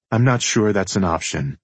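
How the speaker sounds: plain reading voice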